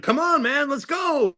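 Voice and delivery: very high, sustained call to hurry; mimicked call from a car window; imitated eager urging. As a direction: urge the driver on in a high, playful voice. Star calling from car window.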